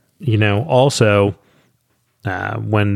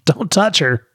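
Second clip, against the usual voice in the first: put-on childish warning; 5 semitones higher than usual. Continuing the playground cooties joke.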